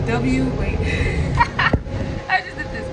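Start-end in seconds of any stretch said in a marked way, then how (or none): 0.8-2.2 an airy, high giggle; real laugh of delight; high breathy laugh. Real, not acted. Laughing at her own W.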